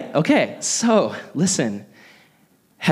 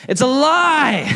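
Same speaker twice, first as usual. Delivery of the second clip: it's a lie; 11 semitones higher, 4 dB louder, slower than usual. Real, not acted. Busting a childhood myth.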